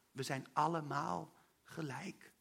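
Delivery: soft and slow, allemaal stressed; slow tender emphasis. Real, not acted. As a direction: lay it down gently and slowly. Herhaalde kernzin in preek. (zacht en langzaam, nadruk op allemaal)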